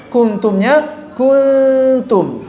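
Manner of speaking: kuntum stretched slowly, tongue away from makhraj. Shown as a demonstration. Teacher modelling a slow correct kuntum. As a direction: say kuntum slowly with a hidden nun. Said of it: "Agak sedikit jauh dari makhraj".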